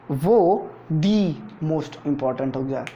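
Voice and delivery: most important said very slowly, each syllable weighted; slow emphasis. Shown as a demonstration. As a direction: lean on most important like a warning. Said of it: "teacher stresses diagram importance". (बहुत धीरे, ज़ोर देकर)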